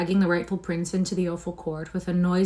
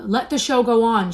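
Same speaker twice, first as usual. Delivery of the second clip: higher, louder shout; 5 semitones higher, 8 dB louder than usual. A narrator's reading. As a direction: shout it to the crowd. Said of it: "shouted Canty".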